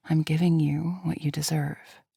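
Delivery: husky whispered promise. Acted. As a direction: breathe it out husky. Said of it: "my own voice husky".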